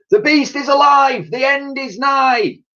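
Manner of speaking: high, drawn-out doom-crier's shout. A narrator's reading. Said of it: "he shouted".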